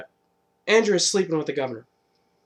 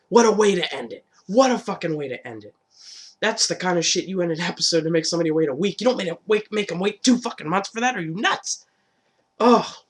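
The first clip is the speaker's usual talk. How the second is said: higher, louder exasperated rant; real indignant rant; 5 semitones higher, 4 dB louder than usual. Real, not acted. Fan ranting at the mid-season cliffhanger, exasperated. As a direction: vent frustration at the show. Ranting about long wait.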